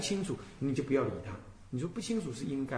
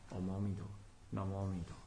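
low, very soft Buddha-name chant; hushed, low devotional murmur; slow settled recitation; 8 semitones lower, 13 dB quieter, much faster than usual. Shown as a demonstration. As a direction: recite inwardly, barely voiced, letting the mind settle. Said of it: "轻轻的提着".

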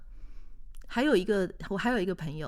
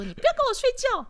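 much higher, louder indignant yell; mock shout at husband; 7 dB louder than usual. Wife yelling at her snoring husband to wake up, high shout. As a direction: shake him awake and shriek it. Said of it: "把他抓起来说".

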